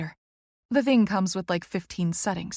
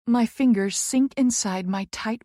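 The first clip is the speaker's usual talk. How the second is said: ordinary delivery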